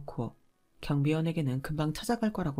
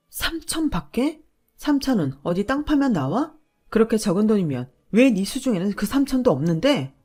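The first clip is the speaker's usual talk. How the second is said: wife throwing the husband's words back at him, louder; outraged disbelief; 4 semitones higher, 7 dB louder than usual. Retold or imitated. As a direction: repeat his words back, stunned and furious.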